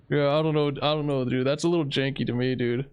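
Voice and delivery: ordinary delivery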